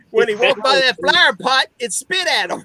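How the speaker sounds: laughing into a near-shout; retelling the spitting cobra, loud and laughing; excited punchline. Retold or imitated. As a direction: burst out with the payoff, laughing. Story punchline about the cobra.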